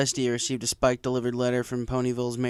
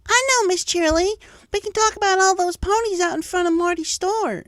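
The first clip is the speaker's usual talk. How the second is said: put-on much higher, loud child voice; eager schoolfilly; 7 dB louder than usual. A narrator's reading. A filly answers her teacher, eager and high. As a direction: blurt it out, hand up.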